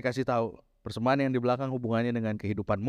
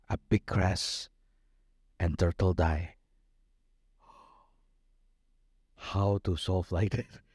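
low, very slow sorrowful retelling; halting, heavy grief without sobs; retold grieving; 5 semitones lower, slower than usual. Preacher voicing a crying person whose friend died, slow and grieving. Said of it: "orangnya nangis".

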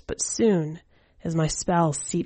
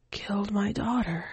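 whisper; 4 semitones higher, 5 dB quieter than usual. Acted. Coaxing the entranced old man.

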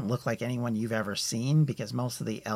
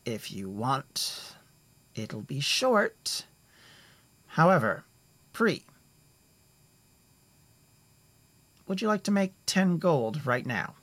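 inviting; 4 semitones higher than usual. Acted. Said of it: "invitingly and nods and says".

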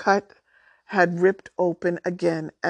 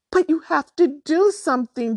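high, loud desperate shriek; 9 semitones higher, 4 dB louder than usual. A narrator's reading. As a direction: shriek it in panic. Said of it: "Drew shrieked".

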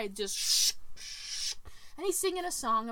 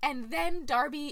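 normal talking voice

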